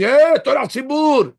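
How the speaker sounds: high, loud, slow mock yell at the high priest; imagined angry yell. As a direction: bellow it like an outraged congregant. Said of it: "nobody's gonna start screaming".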